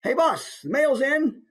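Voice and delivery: high, chirpy mailroom voice; eager high-pitched office junior; office worker. Shown as a demonstration. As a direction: call in to the boss with the post. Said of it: "maybe he's now in an office".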